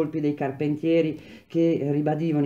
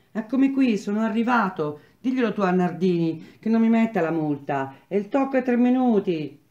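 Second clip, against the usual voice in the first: put-on a breathless young apprentice pleading not to be fined; small boy; 6 semitones higher than usual. The small boy arriving late.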